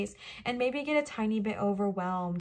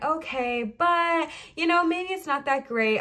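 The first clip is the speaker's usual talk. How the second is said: brushing off praise; 6 semitones higher, 3 dB louder, slower than usual. Retold or imitated. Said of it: "our immediate reaction is to be like".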